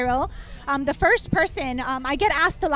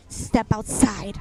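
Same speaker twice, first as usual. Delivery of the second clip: stern teacher; 7 semitones lower, much slower than usual. Retold or imitated. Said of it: "I was scared a little bit 'cause she's like".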